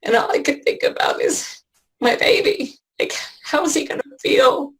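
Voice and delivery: fighting back sobs as she speaks; real crying. Real, not acted. Mother thinking of her son, voice breaking into real tears. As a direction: voice breaking into tears, pitch climbing.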